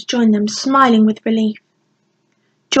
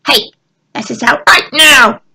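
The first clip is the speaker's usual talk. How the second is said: louder demand, stress on right; 3 semitones higher, 6 dB louder than usual. A narrator's reading. Goblin demanding through the barn door, firm. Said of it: "a goblin demanded".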